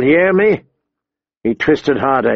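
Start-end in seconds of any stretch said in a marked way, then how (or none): 0.0-0.6 brisk, raised-pitch threat; menacing demand. A narrator's reading. Big man bullying the captive boy, menacing. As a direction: tack it on as a threat. First demand, before twisting harder.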